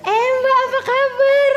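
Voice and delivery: high, loud, over-friendly hello; gushing greeting. Acted. Mother caught mid-scolding greets the ustazah, gushing. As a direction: switch to a hammed-up delighted greeting.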